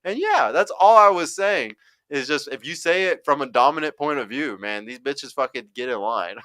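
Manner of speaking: ordinary delivery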